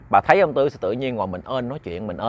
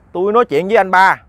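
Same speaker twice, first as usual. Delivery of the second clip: raised, emphatic protest; loud insistence; 5 semitones higher, 7 dB louder than usual. Acted. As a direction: insist loudly to a doubting wife. Husband insists to doubting wife. (nói lớn, khăng khăng)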